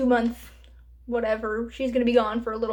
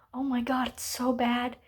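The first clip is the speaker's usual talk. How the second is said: normal talking voice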